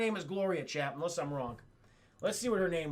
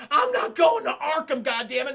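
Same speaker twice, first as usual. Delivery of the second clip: loud, higher furious shout; angry shout; 5 semitones higher, 9 dB louder than usual. Retold or imitated. Harvey yells at his doctor about Arkham, a loud higher shout. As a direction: yell the refusal like a cornered man.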